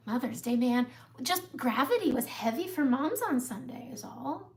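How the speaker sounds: usual speaking voice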